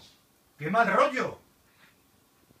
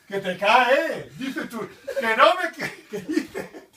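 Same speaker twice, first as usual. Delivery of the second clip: shouting through nervous laughs; panicked laughter; 3 semitones higher, 6 dB louder, slower than usual. Real, not acted.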